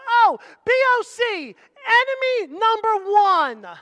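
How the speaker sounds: high, loud, drawn-out outburst; the enemy's alarm. Acted. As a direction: call it out slowly like an enemy naming its top target. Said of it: "the enemy says".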